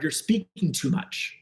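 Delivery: normal talking voice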